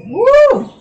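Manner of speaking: high whooping cheer; excited cheer. Real, not acted. Cheering mid exercise count.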